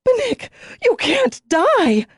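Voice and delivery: very high, slow, desperate cry; begging fate, in horror; high wailing protest; anguished plea. Acted. Realises her mate will die.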